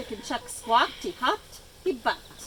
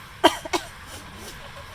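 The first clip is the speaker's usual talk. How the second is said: dry monkey cough; 6 semitones higher than usual. A narrator's reading. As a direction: cough it out rough. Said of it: "They make me cough".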